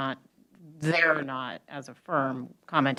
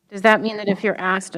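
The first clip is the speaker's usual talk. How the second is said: raised voice so he's heard; louder through mask; 4 semitones higher, 10 dB louder than usual. Real, not acted. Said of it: "I got the mask on".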